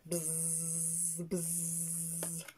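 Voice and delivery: long, droning bee buzz. Acted. Story reader answering what the bee says, soft sustained buzzing. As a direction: hold a soft zzz like a busy bee. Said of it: "What does the bee say?".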